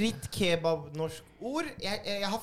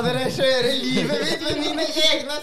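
mock exasperation; 10 semitones higher, 7 dB louder, slower than usual. Real, not acted. Exasperated at personal question.

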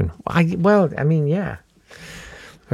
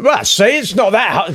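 high mocking voice of a smug landlord; mock posh landlord; 8 semitones higher than usual. Mocking smug landlords on a TV show, high-pitched.

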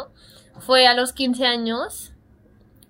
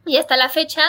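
ordinary talk, nothing marked

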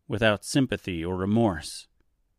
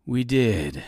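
menacing villain; 2 semitones higher than usual. A narrator's reading. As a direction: savor each word, toying with her. Villain Reginald toying with Dorothy.

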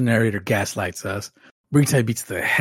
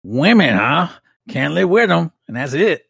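hammed-up fake Southern accent, louder; lightly done fake Southern accent, punchline delivery; 5 semitones higher, 5 dB louder than usual. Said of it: "fake Southern accent".

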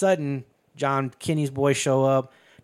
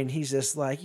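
usual speaking voice

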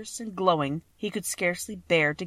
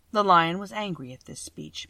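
ordinary delivery

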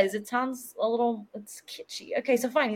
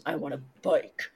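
put-on deadpan child; 6 semitones lower, 3 dB quieter than usual. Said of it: "So Ashley goes".

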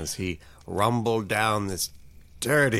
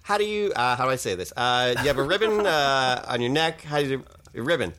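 higher, halting nervous voice; awkward Sean; 6 semitones higher than usual. Acted. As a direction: fumble the question shyly. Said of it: "was like".

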